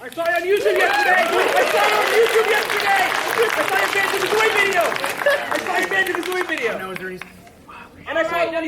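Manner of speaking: high, fast excited shout. Real, not acted. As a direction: blurt it out like an eager fan. Fan shouting excitedly at streamer.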